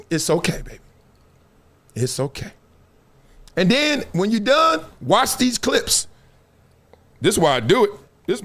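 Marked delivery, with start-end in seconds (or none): 0.1-2.5 soothing. Retold or imitated. Comforting the wailing mourner.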